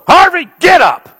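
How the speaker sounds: high, loud, sharp command to get up; commanding shout. Retold or imitated. Pastor shouting at seizing man to get up. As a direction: bark it like an order to stand. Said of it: "I walked over and I go".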